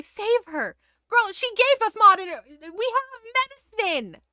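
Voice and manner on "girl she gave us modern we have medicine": much higher, louder incredulous rant; exasperated rant. Real, not acted. Streamer ranting at the mother refusing medicine. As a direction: burst out in disbelief.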